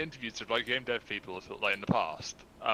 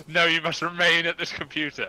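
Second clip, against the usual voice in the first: a louder, higher hammed-up command; mock stern order; 5 semitones higher, 9 dB louder than usual. Joking to the host, a mock stern boss telling them to stay. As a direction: play the strict boss who won't let them leave.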